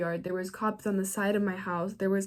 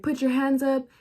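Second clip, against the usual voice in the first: loud police bullhorn order, a bit higher; cops yelling over a megaphone; 6 semitones higher, 5 dB louder than usual. Retold or imitated. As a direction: bark it through a loudspeaker. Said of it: "they're yelling in their speaker".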